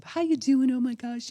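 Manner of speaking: put-on high, bright baby-talk greeting; mom cooing at baby. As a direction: gush over the baby like a delighted mom.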